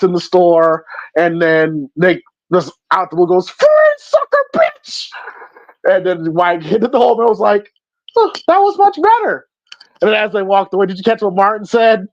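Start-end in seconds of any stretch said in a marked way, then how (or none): 3.5-5.1 high, shouted insult out a window; mock yell. Nick yelling out the window. 8.2-9.5 high, pleased put-on voice; smug mock approval. Mock self-satisfied reaction.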